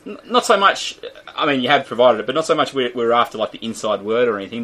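normal talking voice

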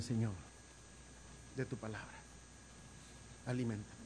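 hushed, intimate prayer, dropping away; soft prayer. Acted. Preacher praying before the congregation, soft intimate prayer. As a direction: pray it quietly, almost to yourself.

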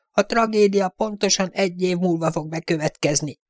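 high, thin voice of a seer foretelling death; a squeaky little seer pronouncing a grim prophecy; ominous fortune-teller. A narrator's reading.

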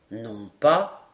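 very low character voice; low-pitched sergeant's reply; denying sergeant. A narrator's reading. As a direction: deny it in a deep register. Sergeant denies they were English.

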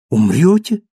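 loud, high, alarmed question; shocked cry. A narrator's reading. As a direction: cry out in disbelief. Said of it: "вскричал Мариус".

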